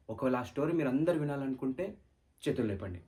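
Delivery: normal talking voice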